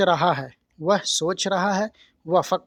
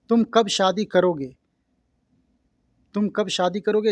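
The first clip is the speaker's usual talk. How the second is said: ordinary talk, nothing marked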